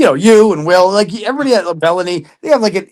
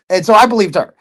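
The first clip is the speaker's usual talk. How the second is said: ordinary talk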